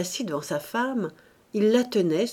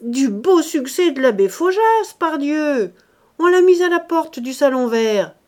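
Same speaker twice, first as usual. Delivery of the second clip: higher, louder gloating; 7 semitones higher, 6 dB louder than usual. Acted. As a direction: crow the gossip loudly. Husband gloating loudly.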